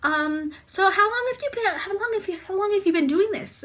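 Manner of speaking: high, stumbling nervous small talk; nervous chatter. Retold or imitated. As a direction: re-enact jittery, halting chitchat. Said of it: "I'm shaking, and I'm talking".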